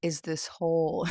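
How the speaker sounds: breaking into laughter; laughing. Real, not acted. Vlogger cracking up before the main story, laughing. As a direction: crack up as the sentence trails into giggles. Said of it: "I gotta stop laughing".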